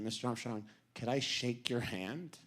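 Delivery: soft, low, hopeful request; timid plea. Retold or imitated. Fan to his astronaut hero in elevator, timid plea.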